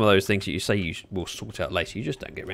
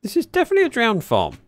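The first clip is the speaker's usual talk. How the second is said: excited; 14 semitones higher, 6 dB louder than usual. Real, not acted. Under attack, startled.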